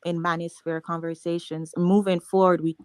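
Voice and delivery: loud, insistent objection; forceful talking over. Real, not acted. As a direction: hold the floor loudly over an interruption. Talking over an interrupting speaker.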